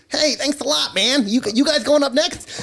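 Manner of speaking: high-pitched, eager dork voice; dorky bassist. Retold or imitated. Goth bassist drops the act after the show, high and dorky. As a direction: imitate an overexcited dork. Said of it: "He's like the biggest fucking dork".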